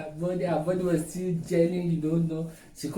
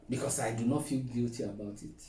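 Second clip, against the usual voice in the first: ordinary talk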